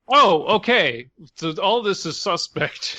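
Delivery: mock dawning suspicion; sarcastic. Real, not acted. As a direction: draw out the oh with irony. Sarcastic reaction to story.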